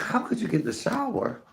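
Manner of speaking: high-pitched incredulous question; retold bafflement. Questioning a friend about unwashed feet, retold bafflement. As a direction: ask it in high, mock disbelief. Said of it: "I didn't understand that".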